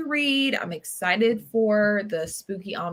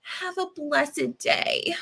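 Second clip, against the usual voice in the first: higher, slow, mock saccharine; fake sweet sign-off; 8 semitones higher, much slower than usual. The wife dismisses her with a mock sweet blessing. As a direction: drag it out sickly sweet. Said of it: "And she's like".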